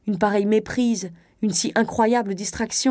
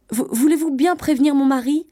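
higher, louder, stumbling into an urgent request; anxious wife; 7 semitones higher, 5 dB louder than usual. A narrator's reading. Wife begging the conductor to warn her husband, anxious. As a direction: plead as a worried wife, pitch climbing.

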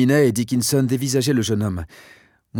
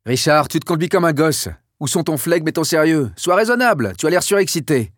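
a stern reproach pitched higher and louder; scolding general; 6 semitones higher, 4 dB louder than usual. Acted. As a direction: scold him like an overexcited child. General scolds his excited friend.

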